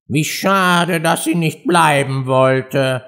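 drawn-out, sighing parrot voice; sighing regret. A narrator's reading. Parrot laments the mouse's departure, sighing. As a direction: sigh it slowly with regret. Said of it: "seufzte der Papagei".

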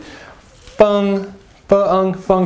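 FENG held high and level; first tone. Shown as a demonstration. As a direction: keep FENG on a steady high note. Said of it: "first tone".

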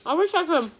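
talking between bites; words slightly muffled by food; mouth full, chewing. Real, not acted. Seen 4: Cheeks bulge and jaw works through early words; food bitten before.

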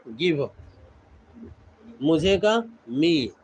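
normal talking voice

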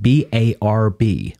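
slow American spelling-out; American accent. Shown as a demonstration. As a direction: spell it slowly with American R's. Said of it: "do it in American accent".

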